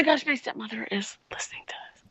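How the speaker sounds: fast, urgent whisper. Real, not acted. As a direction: hush it, someone might hear. Whispering, stepmother is listening.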